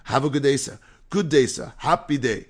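put-on loud, chanting run of Caribbean-accented greetings; imitated Caribbean-sounding accent. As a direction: keep the cheerful greetings rolling, mimicking a Caribbean accent. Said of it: "when I do this accent".

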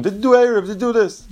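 high-pitched demo of a screaming reminder; "Did you do Erev?" flung out high as a nagging scream; mock scream. Shown as a demonstration. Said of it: "Don't scream".

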